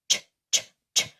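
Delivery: voiceless, short "Ch" bursts; ch sound alone. Shown as a demonstration. Teacher demonstrating tongue position, short voiceless ch. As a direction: pop out "Ch" with no voice, tongue up. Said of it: "your tongue will go up".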